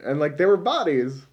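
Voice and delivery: ordinary talk, nothing marked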